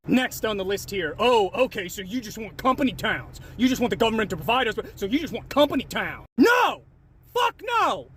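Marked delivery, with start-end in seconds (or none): 1.2-6.3 mocking commenter. Voicing a commenter's objection. 6.4-8.1 real outraged yell, higher and louder; angry shout. Real, not acted. Angry rebuttal to commenters.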